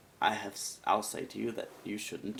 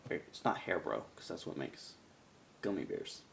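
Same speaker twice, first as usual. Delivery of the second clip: ordinary talk, nothing marked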